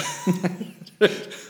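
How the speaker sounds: quick airy giggle; a short breathy chuckle; breathy laugh. Real, not acted. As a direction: laugh under the breath at the joke. Laughing at joke.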